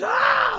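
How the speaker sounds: sudden high yelp of pain; imitated scream of pain; pained yell. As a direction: yelp like you've just been hit. Victim yelps as he's chopped.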